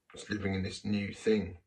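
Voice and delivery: ordinary talk, nothing marked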